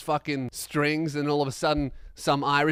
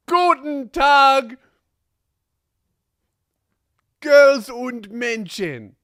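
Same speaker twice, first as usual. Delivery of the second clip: slow, loud, higher hammed-up German greeting; mock German host; 8 semitones higher, 9 dB louder, much slower than usual. Opening the podcast's German half, a hammed-up slow loud greeting. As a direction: greet the crowd like a pompous German announcer, slowly. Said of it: "Here begins the second half".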